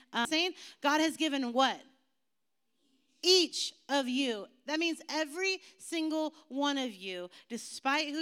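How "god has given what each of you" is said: higher and louder, each of you slow; emphatic prompt. Shown as a demonstration. Pastor quizzing the youth group, emphatic.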